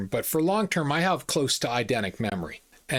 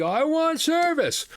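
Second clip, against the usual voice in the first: high, drawn-out whining demand; nasty businessman; 14 semitones higher, 3 dB louder than usual. Retold or imitated. Rude businessman insists again to the manager, high whine. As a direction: insist like a spoiled child. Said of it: "The nasty Quebecois businessman".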